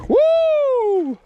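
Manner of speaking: a high, ringing woo of joy; excited whoop. Real, not acted. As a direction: whoop as the fish bites. Fish on the line.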